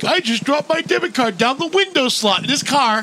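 high-pitched mock distress; retold exasperated confession. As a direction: imitate a friend wailing about his own blunder. Retelling friend's distressed confession.

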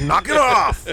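high, mock exasperated shout; imitated fed-up yell. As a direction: join in mimicking the pestered coworker. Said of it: "he gets that voice".

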